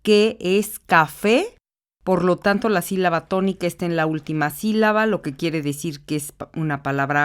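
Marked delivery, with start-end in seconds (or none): 0.0-1.6 café drawn out slowly, rising on FÉ; slow teacher demo of café stressed on FÉ; slow syllable stress demo. Shown as a demonstration. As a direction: spell out café slowly, leaning on fe. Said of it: "Entonces vemos".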